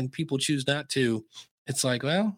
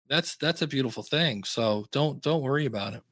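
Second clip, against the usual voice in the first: ordinary talk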